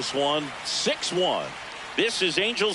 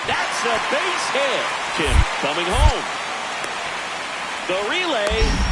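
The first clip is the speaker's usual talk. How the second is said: excited play-by-play; 6 semitones higher, 4 dB louder than usual. Real, not acted. Live call of scoring play.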